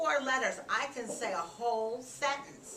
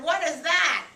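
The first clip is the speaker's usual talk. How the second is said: higher, louder pointed question; alarmed Papa; 7 semitones higher, 7 dB louder than usual. A narrator's reading. Papa points at the mess on the floor, mildly alarmed. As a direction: demand an explanation, lightly acted. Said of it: "Papa pointed to the floor and said".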